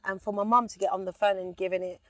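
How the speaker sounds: ordinary talk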